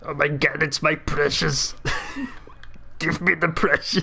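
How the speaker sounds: hammed-up action star playing Smeagol; Arnold Schwarzenegger. Action star recast as Smeagol craving the precious, Austrian accent. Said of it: "Smeagol from The Lord of the Rings".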